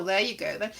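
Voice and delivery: ordinary talk, nothing marked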